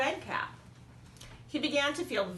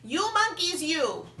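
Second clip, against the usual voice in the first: high, louder scolding; scolding peddler; 8 semitones higher, 5 dB louder than usual. A narrator's reading. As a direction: wag a finger at the monkeys. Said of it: "he spoke to them".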